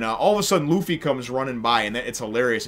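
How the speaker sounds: ordinary talk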